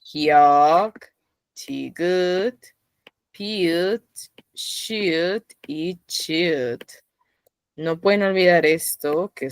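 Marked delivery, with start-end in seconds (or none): 0.0-6.8 "Hyuk, tigut, piut, shiut y chiut" – कोरियाई व्यंजन नाम साफ़-साफ़; Korean consonant names. Shown as a demonstration. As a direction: spell out each Korean letter name. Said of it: "Pero las demás no".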